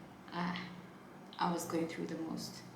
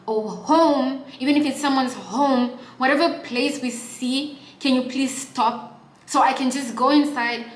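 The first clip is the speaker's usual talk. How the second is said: raised-voice ultimatum to the stubborn driver; fed-up plea; 4 semitones higher, 10 dB louder than usual. Retold or imitated. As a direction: beg him to stop, at the end of your rope. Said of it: "and I tell him".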